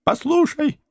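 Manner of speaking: high, urgent female plea; high pleading voice. Acted. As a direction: cry out to stop him.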